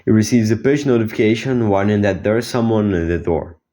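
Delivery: normal talking voice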